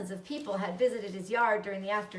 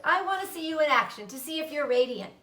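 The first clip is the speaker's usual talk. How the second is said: brisk coach; 5 semitones higher, 5 dB louder than usual. Acted. Charlotte drilling Wilbur.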